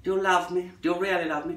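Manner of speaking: a crooning mock American accent. Singer pleading with a lover, mock American accent. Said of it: "No, that's not really American".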